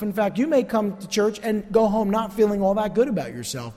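ordinary talk, nothing marked

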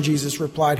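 normal talking voice

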